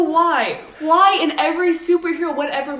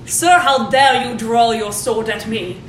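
slow, loud, full Shakespearean shark; eloquent shark voice; 6 dB louder, slower than usual. Acted. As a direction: declaim it like a grand Shakespearean shark. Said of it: "eloquent in shark voice".

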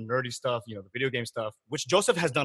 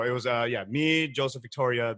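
ordinary talk, nothing marked

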